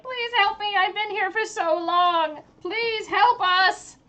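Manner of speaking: high, drawn-out desperate wail; screaming plea. Acted. Game master as captive kobold clutching a rock, screaming for help. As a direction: beg for rescue in a high voice. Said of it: "screaming out in bloody murder".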